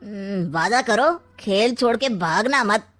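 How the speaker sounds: ordinary talk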